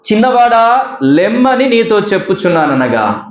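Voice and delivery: high-pitched scriptural proclamation; proclaimed command. Acted. Preacher reads Jesus' command to the dead young man, proclaimed. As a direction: call it out high, then let it fall.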